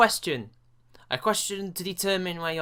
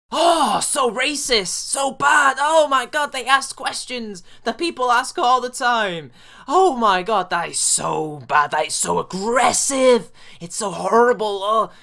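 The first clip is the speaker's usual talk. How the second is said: hammed-up shocked gasping, higher and louder; mock outrage; 4 semitones higher, 5 dB louder than usual. Mocking critics of harmless questions with hammed-up fake horror. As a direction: clutch your pearls in fake horror.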